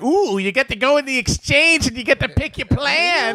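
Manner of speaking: high, put-on gushing voice; mock rule-loving nerd. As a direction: mimic a nerd thrilled by bureaucracy. Mocking fans of bureaucratic rules.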